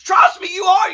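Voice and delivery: high, loud, impatient reassurance; retold girlfriend losing patience; exasperated insistence. Girlfriend scolds him for counting.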